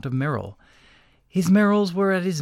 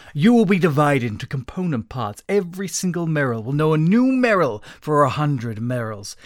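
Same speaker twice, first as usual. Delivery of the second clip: raised, loud voice with suppressed feeling; quivering voice; 3 semitones higher, 6 dB louder than usual. A narrator's reading. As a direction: quiver with held-in emotion, pushing louder. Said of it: "voice quivering with suppressed Merrill".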